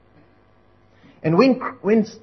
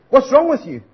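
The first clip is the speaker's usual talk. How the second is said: high mocking taunt; scornful coworker; 7 semitones higher, 4 dB louder than usual. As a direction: ridicule him. Coworker mocking man saying grace.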